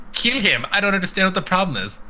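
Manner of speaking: usual speaking voice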